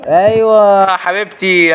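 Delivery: high, slow, teasing endearment; drawn-out loud mock tender call; mock sweet coo. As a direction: coo at granny like a lover.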